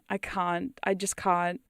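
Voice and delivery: usual speaking voice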